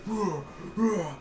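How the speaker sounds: high grunting ruh ruh; short silly growls; mock growling. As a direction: imitate a kid smashing things in a rage. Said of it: "He's like".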